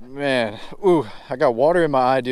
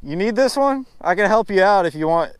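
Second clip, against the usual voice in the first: higher teasing offer; mock generous; 7 semitones higher than usual. Real, not acted. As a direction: rub it in with fake kindness. Mock offering fish to rival.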